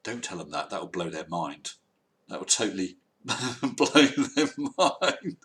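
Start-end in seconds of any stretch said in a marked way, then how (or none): 3.1-5.4 words breaking up into laughter; laughing. Real, not acted. Host joking with viewers about flat earthers' minds, laughing. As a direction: laugh through the words, pitch climbing.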